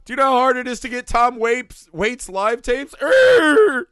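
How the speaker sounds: high, loud put-on whiny voice; impression of the brother. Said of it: "He's like".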